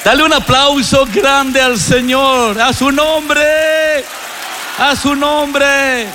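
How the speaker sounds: high, slow, ringing preacher's call; rousing call. Real, not acted. Preacher urges congregation to praise God, rousing shout. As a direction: lift the crowd to applaud.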